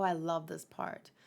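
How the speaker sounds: usual speaking voice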